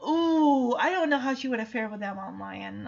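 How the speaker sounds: ordinary talk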